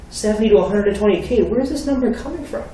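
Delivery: high-pitched, mock disbelief; retold astonished comeback; incredulous. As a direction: question it in high-voiced disbelief. Son retelling his incredulous reply.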